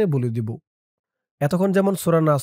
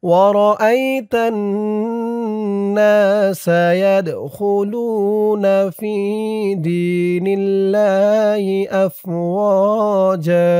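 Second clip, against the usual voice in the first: slow, drawn-out chanted Quran recitation, higher; 3 semitones higher, much slower than usual. Shown as a demonstration. Teacher chanting the verse for students, slow melodic recitation. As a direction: chant each word long and melodic.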